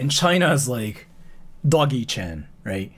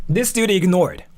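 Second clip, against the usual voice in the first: ordinary talk, nothing marked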